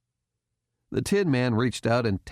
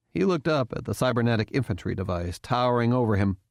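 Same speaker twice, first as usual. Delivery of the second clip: plain reading voice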